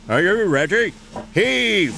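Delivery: high, loud command to pull; called out. Acted. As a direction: shout the order across. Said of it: "called the Fat Controller".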